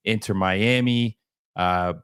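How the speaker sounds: ordinary talk, nothing marked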